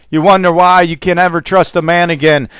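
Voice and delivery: normal talking voice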